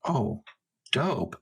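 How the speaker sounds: ordinary talk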